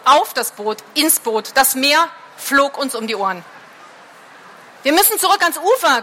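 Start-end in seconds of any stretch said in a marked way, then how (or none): 4.8-6.0 shrieking. A narrator's reading. Mareike Helene cries out over the storm, shrieking in panic. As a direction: yell it as the boat is swamped. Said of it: "kreischte Mareike Helene".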